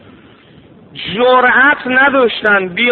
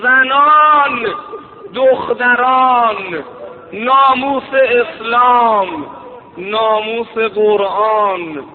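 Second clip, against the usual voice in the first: higher, slow wailing plea; climactic rowzeh lament; 4 semitones higher than usual. Acted. Preacher voicing the Imam's plea for the women, wailing. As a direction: build the cry to defend the tents.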